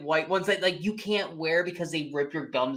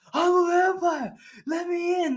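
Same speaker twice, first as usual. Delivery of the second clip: high-pitched, hammed-up cry; mock crazed wail; 12 semitones higher, 3 dB louder than usual. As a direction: wail it like a madman demanding entry. Retold madman yelling at club entrance.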